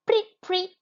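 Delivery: high, sharp preet; teacher imitating a whistle in the review; whistle imitation. Shown as a demonstration. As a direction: blow it like a police whistle. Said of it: "one more time".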